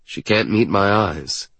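usual speaking voice

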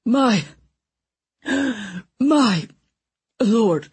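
high, breathless wheeze; wheezing. A narrator's reading. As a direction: gasp out between breaths. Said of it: "the man wheezed".

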